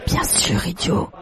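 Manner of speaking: hushed, breath-only retort; whispered reply. A narrator's reading. Juan leaning toward Pedro, hushed whispered retort. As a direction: whisper it back like a secret.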